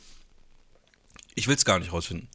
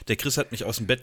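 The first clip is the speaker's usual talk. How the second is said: usual speaking voice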